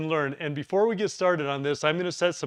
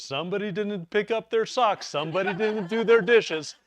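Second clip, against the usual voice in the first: higher-pitched mock wife complaining; imitating nagging wife; 6 semitones higher than usual. Husband mimics wife scolding about chores, higher mock voice. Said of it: "My wife always says".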